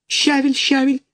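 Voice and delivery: little chicks chirping about sorrel; light squeaky gosling murmur. A narrator's reading. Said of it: "шепчут гусенята".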